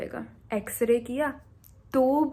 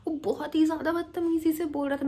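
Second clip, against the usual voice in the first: ordinary talk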